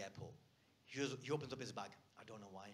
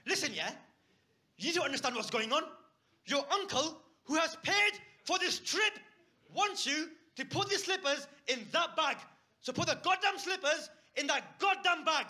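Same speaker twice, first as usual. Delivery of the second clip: furious shout, much higher and louder; lost temper; 13 semitones higher, 9 dB louder than usual. Retold or imitated. Uncle loses his temper at the nephew over the slippers, shouting. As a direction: blow up at him, hammering each demand. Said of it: "I lost my temper".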